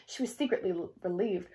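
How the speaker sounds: plain reading voice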